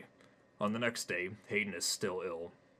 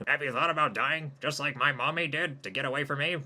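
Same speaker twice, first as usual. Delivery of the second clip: higher, louder alien; eerie alien friend asking; 8 semitones higher, 10 dB louder than usual. Acted. Jimmy the alien asks Hayden about dying.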